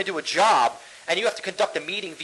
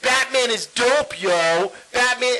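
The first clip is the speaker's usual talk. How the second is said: imitated slangy crowd, higher pitched; mock a slang-heavy movie fan; drawn-out, higher mock street slang; rowdy moviegoer; 4 semitones higher, much slower than usual. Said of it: "all I'm gonna hear is".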